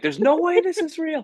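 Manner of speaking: high-pitched mock desperate wail; losing it. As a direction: protest in hammed-up disbelief. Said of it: "I'm absolutely losing it".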